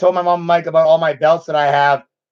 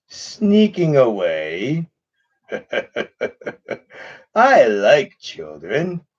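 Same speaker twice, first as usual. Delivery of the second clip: low, slow, eerie rasping voice; creepy villain voice; 8 semitones lower, 5 dB quieter, much slower than usual. Retold or imitated. Streamer shows off a voice from Neverending Story, low and slow creepy tones. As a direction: lure the children in slowly and sinisterly. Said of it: "how well I do this voice".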